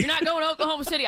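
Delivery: high, fast Oklahoma twang parroted back; mock Oklahoma accent. Texan echoes the line in a quick mock Oklahoma accent. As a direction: rattle it off in a put-on Oklahoma accent. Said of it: "with that accent".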